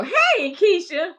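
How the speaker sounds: high, bright call-out to a friend; host hails a viewer who joined the stream, excited; excited greeting. Real, not acted. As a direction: greet her like she just walked in.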